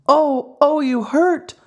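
tiny high wooden voice crying out in pain; faraway little voice crying. A narrator's reading. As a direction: squeal it like a small hurt puppet. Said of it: "cried the same faraway little voice".

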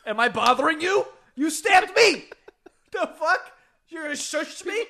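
high, loud laughing outrage; outraged stabbed victim. Pretend. Stabbed victim yelling at the killer who shushed him, high laughing outrage. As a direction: squeal indignantly at the killer.

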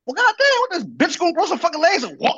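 very high, loud squawked complaint; mock impatient rant. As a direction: exaggerate the co-host's coffee outburst. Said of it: "You said".

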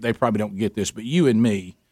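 usual speaking voice

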